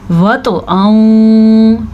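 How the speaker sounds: व तो आऊँ with echoing t and long nasal end; व तो आऊँ, jolted consonant, held hum; qalqala on ta. Shown as a demonstration. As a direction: chant व तो आऊँ slowly, bouncing the t. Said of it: "तो आ के ऊपर कलकला".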